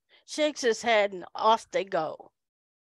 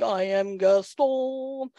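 slow, drawn-out grand proclamation; swaggering mock entrance, stretched out; Gaston; slower than usual. Imagined muscleman walking in, slow grand announcement. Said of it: "he looked like somebody walking in going".

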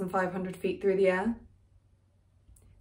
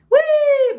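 high, loud "whee" like a falling cartoon figure; 11 dB louder than usual. Mimicking the man's crash into the garden, cartoon whee. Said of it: "Like a cartoon".

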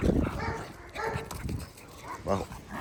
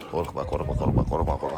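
soothing; 8 semitones lower, 5 dB quieter than usual. Real, not acted. Soothing a frightened puppy.

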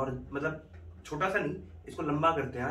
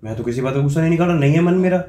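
mock anger; 3 semitones higher, 9 dB louder than usual. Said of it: "किस बात का गुस्सा निकाल रहे हो खाने पे?".

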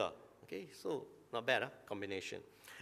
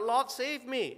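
cry for help; 10 semitones higher, 6 dB louder, slower than usual. Retold or imitated. Peter cries out sinking.

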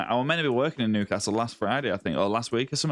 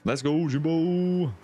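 high, slow, stretched-out "Let's go, Jimbo"; excited drawn-out cheer; 8 semitones higher, much slower than usual. Real, not acted. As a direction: stretch every word like a hype call. Cheering on a catch.

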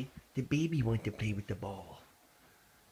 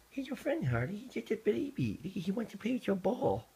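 much higher, sing-song coaxing of the dog; 7 semitones higher than usual. Real, not acted. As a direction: coo up high to win the dog over to the kitten. Coaxing dog about the kitten.

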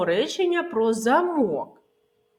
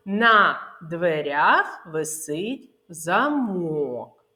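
slow, word by word, замок as за-МОК; stress on the last syllable of замок; 4 semitones lower, much slower than usual. Shown as a demonstration. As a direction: draw out the МОК in замок, the lock. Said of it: "необхідно правильно вимовляти слова".

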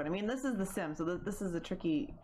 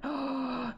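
sharp gasp; 6 semitones higher than usual. Real, not acted. Shocked by game event.

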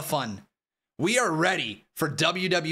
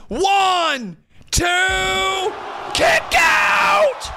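shouted, hyped wrestling commentary; live play-by-play; 8 dB louder, much slower than usual. Acted. Streamer calls the title match finish for chat, shouting and hyped. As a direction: scream the big moments like a ringside caller.

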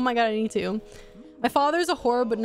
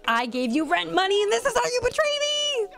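high, mock outraged betrayed lover; hammed-up jilted boyfriend squeal; jealous Daniel; 10 semitones higher than usual. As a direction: play the wronged man, hurt and accusing. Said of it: "He's like".

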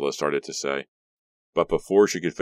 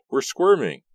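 pitched-up mock whining repeat of "We're squirming"; higher mock whine; 10 semitones higher, 6 dB louder than usual. A narrator's reading. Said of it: "Ricky said".

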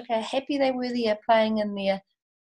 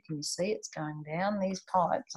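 ordinary delivery

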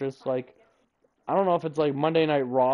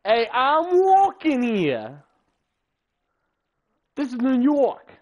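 higher, louder, slow New York tough guy; hammed-up New York accent; 8 semitones higher, 6 dB louder, slower than usual. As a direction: stretch it out like a brash New York cabbie.

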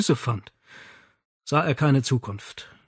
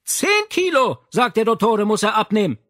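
mother shouts out the window to her husband; loud call out the window; 9 semitones higher, 5 dB louder than usual. Acted. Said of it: "Mit lauter Stimme rief sie zum Fenster hinaus".

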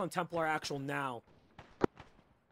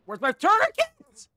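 high, loud, slow panicked yell; man down shout; 12 dB louder, slower than usual. Real, not acted. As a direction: scream it out in desperation. Repeats it, wounded and panicking.

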